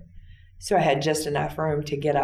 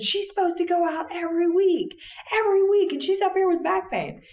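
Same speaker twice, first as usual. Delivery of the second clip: retold screaming. Shift supervisor to staff about a missed clinic visit, retold screaming. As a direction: imitate a supervisor screaming in high-pitched outrage. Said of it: "she started screaming".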